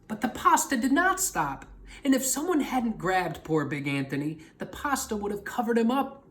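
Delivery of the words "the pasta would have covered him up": plain reading voice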